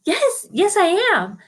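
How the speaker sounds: high, bright-eyed yes; eager pupil. Retold or imitated. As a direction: answer way up high, expecting to be liked. Eager pupil answers teacher.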